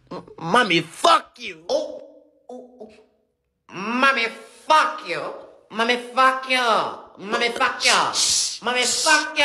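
parrot voice. Acted. Parrot cussing out the mother, parrot voice. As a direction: squawk it like a cheeky parrot.

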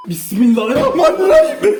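screaming. Real, not acted. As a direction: shriek the prayer in terror. Screaming prayer in fright.

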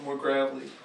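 ordinary talk

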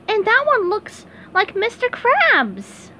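high, bright cartoon sponge voice; squeaky eager SpongeBob.

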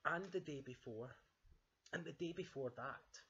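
lightly voiced cub, insistent; Baby Bear complaining. Baby Bear keeps reminding Dad, lightly childlike insistence. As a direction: press the point in a light cub voice. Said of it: "said Baby Bear".

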